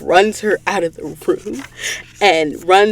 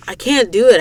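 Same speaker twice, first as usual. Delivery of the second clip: plain reading voice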